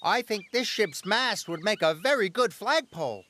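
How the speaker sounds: begging the boss to spare the pine tree; Henry pleading. A narrator's reading. Engine begging the controller to spare the tree, lightly voiced plea.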